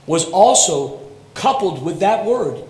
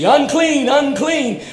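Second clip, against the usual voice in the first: leper's cry; 8 semitones higher, 3 dB louder, slower than usual. Retold or imitated. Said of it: "Such is the response to leprosy".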